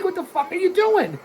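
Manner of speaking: exasperated outburst. Real, not acted. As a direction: throw your hands up in disbelief. Yells at wrestler not hooking leg.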